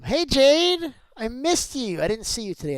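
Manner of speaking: calling out high and loud; big happy hello to a friend; excited greeting. Real, not acted.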